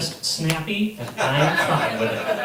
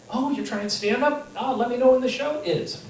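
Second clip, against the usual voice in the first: high, sneering mock encouragement; patronizing sing-song voice; 9 semitones higher than usual. Mocking voice of doubters.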